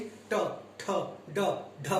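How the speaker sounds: ट ठ ड ढ said as separate sounds, each with a short pause; retroflex consonants. Shown as a demonstration. Teacher demonstrating retroflex sounds to students, each clearly articulated. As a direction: tap out ट ठ ड ढ one at a time. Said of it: "ट बोल के देखिए आप".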